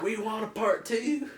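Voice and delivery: put-on high, slow French-accented plea; mock French accent. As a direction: pipe up in a drawn-out French accent. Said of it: "some random Frenchman's like".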